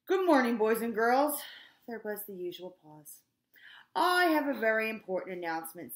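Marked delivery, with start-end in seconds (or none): 0.1-1.4 booming, much louder announcement voice; principal on the loudspeaker. A narrator's reading. Mister Kitzwatter greets the school over the loudspeaker, booming loud. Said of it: "voice came o-, over the loudspeaker". 3.9-5.9 booming, much louder announcement voice; principal on the loudspeaker. A narrator's reading. Mister Kitzwatter greets the school over the loudspeaker, booming loud. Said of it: "said Mister K".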